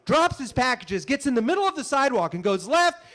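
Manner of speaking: ordinary talk, nothing marked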